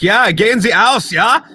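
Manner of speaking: loud, high, slow mock-German come-on. Showing why German never works on women, loud mock German. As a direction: belt out a hammed-up German pickup line. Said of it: "second language is fucking German".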